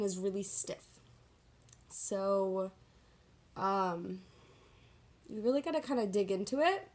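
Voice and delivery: ordinary talk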